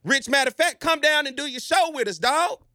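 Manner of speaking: high, eager invitation to come visit; excited pitch to Rich. Retold or imitated. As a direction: call him down like a hype man. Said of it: "By just saying".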